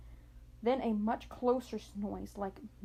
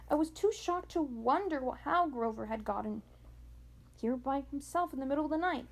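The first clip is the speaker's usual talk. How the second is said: plain reading voice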